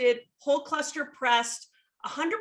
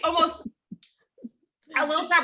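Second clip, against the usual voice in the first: normal talking voice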